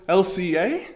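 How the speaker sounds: "L C A" rising sharply at the end, like a question; read as a question. Acted. Host misreading a teleprompter question mark, "L C A" as a rising question. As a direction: lift the A of "L C A" into a puzzled question. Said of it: "put a question mark in my teleprompter".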